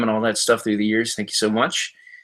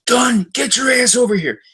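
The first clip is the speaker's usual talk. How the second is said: mock yell; 12 semitones higher than usual. Evil Jared running after Ryan Dunn, hammed-up high yell. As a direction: shout after someone you're chasing. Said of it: "He goes".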